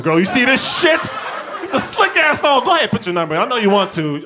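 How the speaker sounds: high, loud show-off pitch; loud bragging. Acted. Phone owner hitting on girls, loudly boastful. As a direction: flaunt it with hammed-up excitement.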